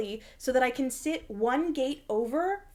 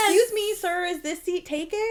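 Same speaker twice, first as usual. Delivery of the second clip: mock polite stranger; 7 semitones higher, 3 dB louder than usual. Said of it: "when I'm like".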